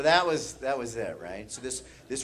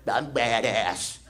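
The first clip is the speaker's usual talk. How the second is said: slow, drawn-out mock-tough boast; slow put-on tough voice; little brother's boast; 3 semitones lower, much slower than usual. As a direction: play a kid who thinks he's tough. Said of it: "'cause I quote".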